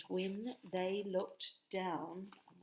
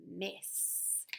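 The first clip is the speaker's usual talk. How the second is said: whispered mess; 7 dB quieter than usual. Acted. Betty whispers the word.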